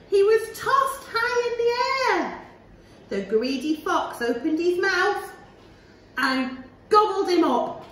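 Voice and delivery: ordinary delivery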